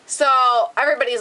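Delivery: ordinary talk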